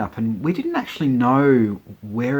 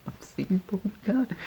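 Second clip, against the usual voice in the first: giddy mum; 9 semitones higher, 7 dB quieter than usual. Retold or imitated. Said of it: "Mum was all kind of".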